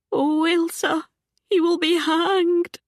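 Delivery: frightened aunt near tears, slowed; voice breaking. A narrator's reading. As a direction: let the voice crack on hanged. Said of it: "Jean's voice broke".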